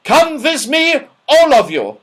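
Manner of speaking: put-on high, loud scolding old dame; loud frightening voice. Dame Snap scolding Joe for lying, loud and frightening. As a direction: scold the boy like a fierce schoolmistress.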